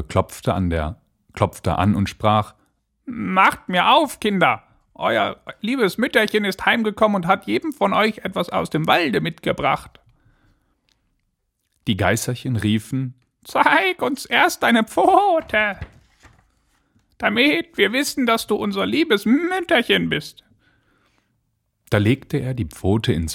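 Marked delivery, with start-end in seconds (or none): 3.0-10.0 high, fine fake-mother voice; wolf putting on a high, dainty voice; disguised wolf. A narrator's reading. Wolf tricks the kids at the door, high disguised voice. 13.4-20.4 very high, put-on kid voice; squeaky-high wary little goats. A narrator's reading. Goat kids demand proof from the visitor, high childlike voice.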